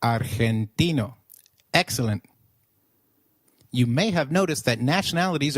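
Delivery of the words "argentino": Argentino slowly, as "ar-hen-TEE-no"; slow Spanish Argentino, g breathed like h; G as throaty H. Shown as a demonstration. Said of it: "try saying argentino again".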